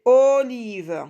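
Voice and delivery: OLIVE slow and loud, long O then long I; syllable by syllable. Shown as a demonstration. Teacher locating the O for pupils, syllable by syllable. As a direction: stretch "olive" as o-live. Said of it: "dans la première syllabe".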